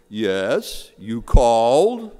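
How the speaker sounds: booming, slow voice of God; big voice from heaven. Acted. God answering the fallen atheist, big heavenly voice. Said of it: "this big voice that came from the heavens".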